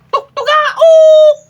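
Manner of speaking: high, loud, drawn-out rooster crow. Retold or imitated. Imitating the rooster for viewers, high and loud. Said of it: "Sabi ng manok".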